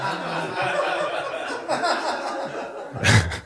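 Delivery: a quick airy laugh at the joke; breathy laugh. Real, not acted. Laughing at own punchline.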